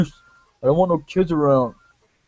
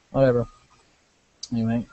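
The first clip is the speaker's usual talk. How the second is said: ordinary talk, nothing marked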